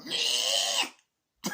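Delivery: pig bleat. Retold or imitated. Imitating the pig in the film for viewers, mock squeal. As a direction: do the pig's odd little noise. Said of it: "you just hear the pig go".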